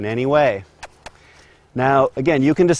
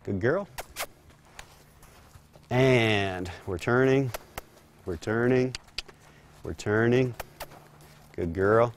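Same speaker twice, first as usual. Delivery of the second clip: calm her through the turn; quiet soothing to the mare; 5 dB quieter than usual. Real, not acted.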